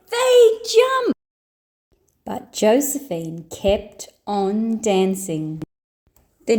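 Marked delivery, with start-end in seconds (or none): 0.1-1.1 high, loud cheeky yell; high shouted little joey; kid brother yell. Acted. Joey yelling at sister.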